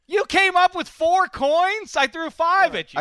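loud, high, drawn-out challenge; mock incredulous shout. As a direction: cry out in hammed-up disbelief. Mock challenge over caught coins.

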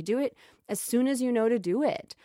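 ordinary talk, nothing marked

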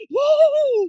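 loud, high mock squeal of glee; high gleeful squeal. Imitating Elisa laughing on the front seat, high squeal. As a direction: squeal like a child laughing on a bumpy ride. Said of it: "tá rindo assim, ó".

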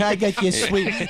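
slow, laughing mock threat; hammed-up Brooklyn menace; Brooklyn tough guy. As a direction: growl it like a wiseguy.

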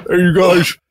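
usual speaking voice

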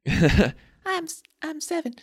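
put-on high squeaky kid voice; childlike squeak. Joking reply to how old.

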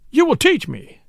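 high, insistent demand; urgent cry. A narrator's reading. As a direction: cry it out as a desperate command. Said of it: "I cried".

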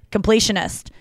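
ordinary talk

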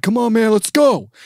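high, loud push; Ultimate Warrior imitation. Ultimate Warrior pushing kids on, loud. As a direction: hurry them on, yelling. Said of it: "He's like".

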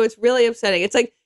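ordinary delivery

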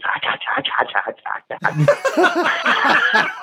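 high breathy "hacha" bursts cracking into laughter; breathless laughing imitation of Gary's "hacha"; mimicked wheezy laughing "hacha". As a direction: copy the mate's wheezing cackle while laughing. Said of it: "I just heard this".

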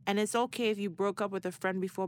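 ordinary talk, nothing marked

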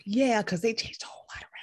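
whispered snide aside; whispering. Acted. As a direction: whisper it like a secret. Snide aside about Disney.

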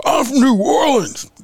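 exaggerated parody of a scary line; mock tough guy. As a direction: glare and announce it like a comic menace. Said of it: "if I looked at you and said".

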